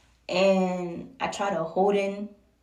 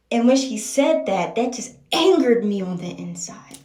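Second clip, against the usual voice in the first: much louder, leaning hard on the key word; angered outburst; 3 semitones higher, 11 dB louder than usual. Real, not acted. Recalling what his mom said, loudly indignant. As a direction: let the anger burst out mid-story.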